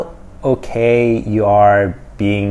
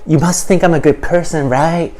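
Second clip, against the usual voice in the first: higher-pitched, eager do-gooder parody; mock progressive; 5 semitones higher than usual. YouTuber parodying a progressive begging for approval, higher mock voice. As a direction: put on an approval-seeking voice. Mocks a self-righteous progressive's talk.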